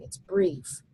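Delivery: ordinary talk, nothing marked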